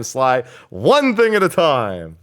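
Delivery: slow, drawn-out exasperated reminder; exasperated admonishing. Real, not acted. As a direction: spell it out slowly for your co-host. Lecturing co-host on troubleshooting.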